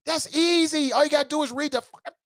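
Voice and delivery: high-pitched exasperation, cut off mid-phrase; getting emotional. Real, not acted. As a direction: let real frustration run away with you. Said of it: "I'm getting emotional".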